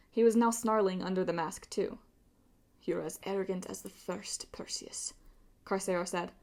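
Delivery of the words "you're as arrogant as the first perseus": menacing hush. A narrator's reading. Villain seething.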